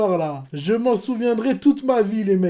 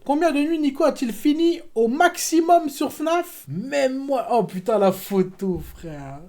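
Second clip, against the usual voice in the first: normal talking voice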